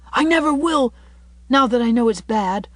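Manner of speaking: put-on high, loud, earnest boy's promise; between sobs. A narrator's reading. Davy promises Anne never again, acted tearful vow. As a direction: vow it like an upset child. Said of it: "asseverated Davy between sobs".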